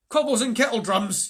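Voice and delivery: high and loud dwarf's grumble; exasperated oath. A narrator's reading. Trumpkin curses the night march, loud exasperated oath. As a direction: blurt out the oath in disgust.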